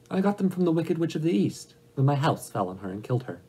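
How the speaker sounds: lightly voiced little girl, starting high; a hint of an earnest child explaining; Dorothy.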